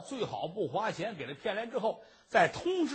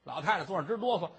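usual speaking voice

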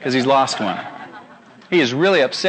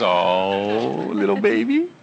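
put-on long low "oh" sliding up into baby talk; doting parent; 7 semitones lower, 3 dB quieter, much slower than usual. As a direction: coo tenderly over a baby. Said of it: "No, the parent says".

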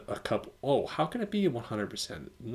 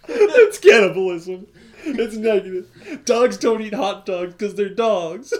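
high, slowed words squeezed out through helpless laughter; laughing so hard it sounds like sobbing; crying with laughter; 11 semitones higher, 10 dB louder, slower than usual. Real, not acted. To cohost about cannibal hot dogs, laughing till crying. Laughing fit over dog movie.

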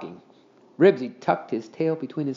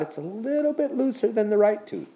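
put-on boy voice; 9 semitones higher than usual. A narrator's reading. Henry's thought voiced.

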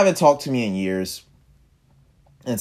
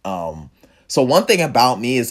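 usual speaking voice

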